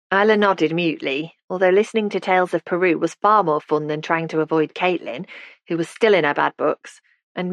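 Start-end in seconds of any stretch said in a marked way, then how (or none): none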